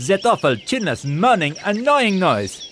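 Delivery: disdainful pelican. Acted. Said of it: "he said".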